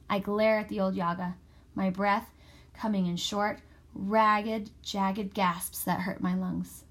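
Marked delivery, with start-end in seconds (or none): none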